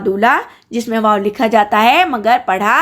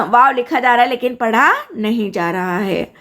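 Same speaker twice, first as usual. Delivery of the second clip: ordinary delivery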